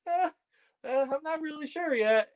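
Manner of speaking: very high, squeaky uncertainty; timid hesitation. Retold or imitated. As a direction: waver meekly in a tiny high voice. Retold hesitant reply to veteran's advice.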